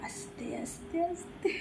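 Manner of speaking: a breathy whisper, barely there. Real, not acted. Mother tiptoes past her sleeping toddler, whispering. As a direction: whisper it so nobody wakes. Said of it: "একটু আস্তে আস্তে কথা বলতে হবে".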